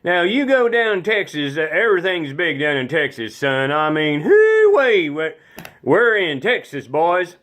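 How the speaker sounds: put-on Texan voice, swooping up; Texas accent. Shown as a demonstration. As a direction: show off a big Texas accent. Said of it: "we're gonna start off with Texas".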